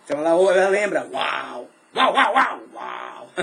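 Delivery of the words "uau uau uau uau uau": mock dog bark. Imitating Simone's dogs barking.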